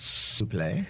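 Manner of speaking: low mock French; French accent. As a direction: toss off s'il vous plaît in French. French phrase for French listeners.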